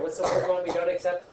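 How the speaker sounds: ordinary delivery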